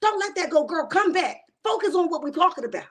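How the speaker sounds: high-pitched, exasperated coaching; urgent mock-mad plea, pitched well up; yelling at TV. Viewer urging Tisha through the TV, exasperated yelling. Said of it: "I'll be the one yelling at the T V".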